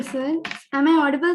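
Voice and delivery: usual speaking voice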